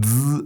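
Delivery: "z" as a sustained buzz, dzz. Shown as a demonstration. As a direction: hold a buzzing dz on "z". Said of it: "this kind of buzzing with a z".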